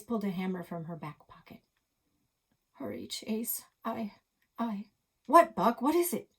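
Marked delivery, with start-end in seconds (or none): 2.7-4.9 weak whisper. Acted. Buck hurt, whispering. 5.3-6.3 higher, louder alarmed cry; alarmed shout. Acted. Chase alarmed.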